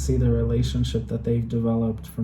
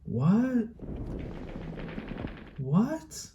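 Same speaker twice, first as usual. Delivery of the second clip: shocked; 9 semitones higher, 7 dB quieter than usual. Real, not acted. Shock at movie twist.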